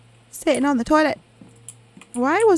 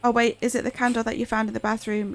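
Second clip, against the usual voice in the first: ordinary talk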